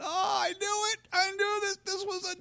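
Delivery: a high, hammed-up whiny wail; a shrieking mock despair ending in no; whining people. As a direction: wail I knew it like a sulky crowd. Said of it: "And the people went".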